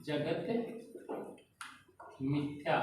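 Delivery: word by word, long pauses between words; dictating slowly so students can write; slow dictation. Shown as a demonstration. Teacher dictating a line to the class, word by word. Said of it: "ऐसे कहें".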